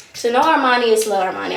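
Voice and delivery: normal talking voice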